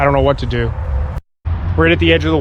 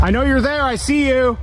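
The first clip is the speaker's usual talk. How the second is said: high but softer call into darkness; tense warning; 9 semitones higher, 4 dB quieter than usual. Real, not acted. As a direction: let them know you see them. Warning unseen intruders, tense.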